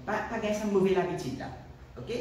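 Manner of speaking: normal talking voice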